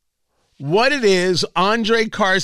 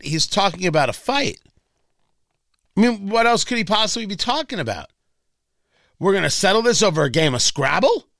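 normal talking voice

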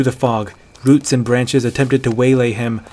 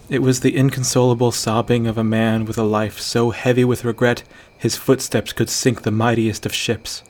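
ordinary delivery, nothing marked

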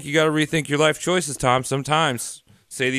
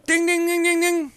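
game show ding; 13 semitones higher than usual. Pretend. Game-show bell for a correct answer.

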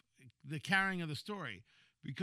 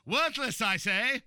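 high, loud villain decree; hammy supervillain pronouncing judgment; evil genius voice; 5 semitones higher, 6 dB louder, slower than usual. Retold or imitated. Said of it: "that's my evil genius voice".

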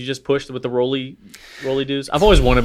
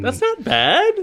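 put-on British accent; much slower than usual. Co-host echoes the British accent.

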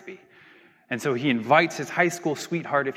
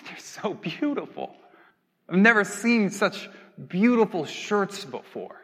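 higher, mock-weepy Daisy; acted tearful awe; 5 semitones higher than usual. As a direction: choke up as if overwhelmed by beauty. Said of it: "she starts crying".

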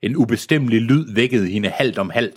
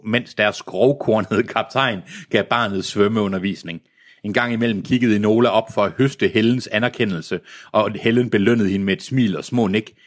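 ordinary delivery

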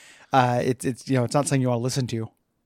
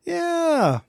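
squeaky high yeah. Acted.

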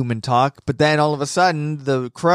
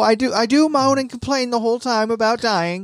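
much higher, whiny sulky voice; whiny Zenitsu; 9 semitones higher than usual. Retold or imitated. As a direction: whine the confession in a squeaky voice. Voicing Zenitsu admitting he whines.

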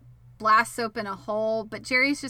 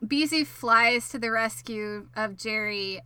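ordinary talk, nothing marked

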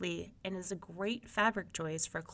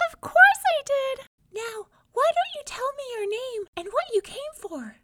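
very high, loud little mouse squeak; tiny voice; 9 dB louder than usual. A narrator's reading. Unseen mouse answers the disappointed young man in a tiny voice. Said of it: "came a tiny voice".

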